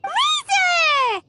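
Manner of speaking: high, drawn-out excited shout; excited call. Acted. Paimon hailing Razor on meeting, excited call. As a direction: call the name out, stretched and falling.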